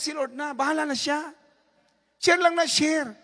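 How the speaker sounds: loud, high, fervent preaching; preaching at full force. Acted. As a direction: rouse the congregation to share. Preaching to congregation. (masigasig na pangangaral nang malakas)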